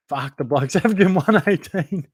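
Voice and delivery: incredulous laughing outburst; laughing disbelief. Real, not acted. Laughing at a player's average.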